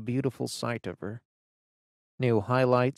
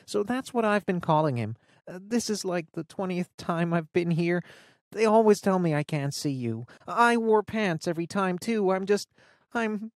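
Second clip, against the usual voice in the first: put-on higher girl's voice, rambling, at times fast; nervous teen friend; 8 semitones higher than usual. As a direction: babble nervously to fill the silence.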